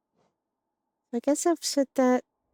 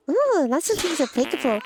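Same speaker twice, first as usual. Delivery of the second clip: ordinary talk, nothing marked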